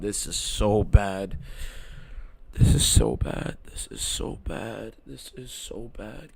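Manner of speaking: slow, soft, almost whispered dismay; slow despair. Real, not acted. Streamer groaning about the fight, slow and soft. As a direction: drag the words out in despair.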